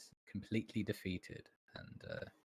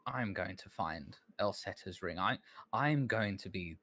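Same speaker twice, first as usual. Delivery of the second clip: put-on higher, louder voice of a petulant young scholar; the bitter assistant who wants out from under her shadow; high, indignant assistant voice; Calmont, resentful; 4 semitones higher, 7 dB louder than usual.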